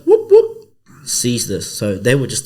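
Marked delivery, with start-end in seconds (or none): 0.1-0.5 high, loud siren whoops; two short vocal siren blips; police siren imitation. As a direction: whoop like a cop car pulling up. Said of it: "cop car goes".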